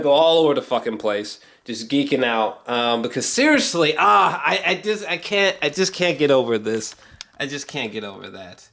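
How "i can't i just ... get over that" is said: normal talking voice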